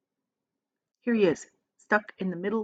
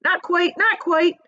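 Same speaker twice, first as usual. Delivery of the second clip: high quick correction; 10 semitones higher, 4 dB louder, slower than usual. A narrator's reading. Another creature corrects.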